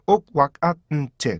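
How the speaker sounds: 옥, 왁, 앗, 은, 치 spoken as separate beats; syllable drill. Shown as a demonstration. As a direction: chop want into five stop sounds. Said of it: "다섯 개의 음절처럼".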